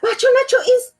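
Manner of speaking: high breathless call; boy calling his father, out of breath; panted. Acted. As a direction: gasp it out after running. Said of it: "he panted".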